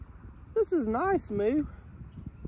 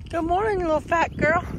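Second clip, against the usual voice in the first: high cooing pet talk; 7 semitones higher than usual. Real, not acted. Cooing again, higher.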